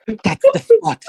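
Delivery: squealing high, loud ecstatic cry; hammed-up moan of pleasure, high and loud; Natalaya. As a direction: bard moaning to Cedric, high squealing cry. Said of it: "As Natalaya".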